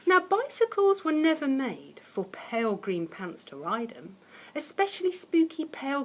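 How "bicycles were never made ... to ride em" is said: plain reading voice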